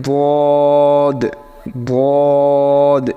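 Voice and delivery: বদ with a heavy, full, very long vowel; thick dad. Shown as a demonstration. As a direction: fill the mouth and stretch বদ. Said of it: "এটা একটু মোটা করে". (মোটা করে টেনে দোয়াদ)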